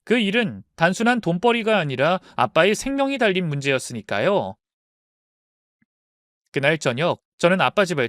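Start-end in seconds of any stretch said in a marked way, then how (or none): none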